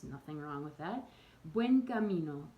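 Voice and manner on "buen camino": "Buen camino" in a Spanish accent, lighter and higher, clean vowels. Shown as a demonstration. Showing pilgrims the native way, Spanish accent. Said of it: "try it with that accent".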